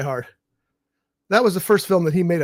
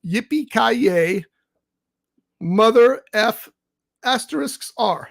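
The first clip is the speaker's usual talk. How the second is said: slow reveal; 4 semitones higher, much slower than usual. Real, not acted. Slow reveal of drink name.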